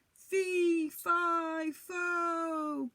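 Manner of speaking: high, loud and distant; falling giant cry. A narrator's reading. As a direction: wail it while falling away. Giant falls.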